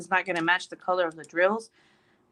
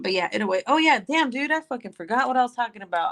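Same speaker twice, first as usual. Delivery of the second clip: ordinary delivery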